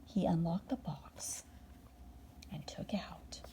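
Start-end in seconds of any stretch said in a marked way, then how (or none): none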